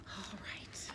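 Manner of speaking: resigned whisper. Acted. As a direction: whisper in defeat. Reader gives in.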